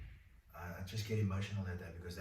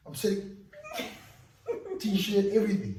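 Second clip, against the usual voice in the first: laughing; 11 semitones higher, 9 dB louder than usual. Real, not acted. Laughing at his own crying.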